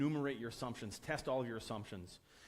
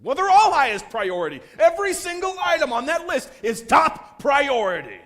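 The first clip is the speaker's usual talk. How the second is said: full-force high bellow, slowing for emphasis; furious yelling; 13 semitones higher, 18 dB louder than usual. Retold or imitated. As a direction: roar it, hammering out each word. Furious product owner answering, acted.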